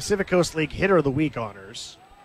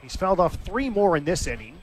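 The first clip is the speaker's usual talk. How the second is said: ordinary delivery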